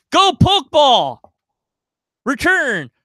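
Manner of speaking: high shouted anime battle cry; Pokemon trainer call. Retold or imitated. Player shouting a Pokemon trainer call at the DM. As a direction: fling out the ball like a cartoon trainer.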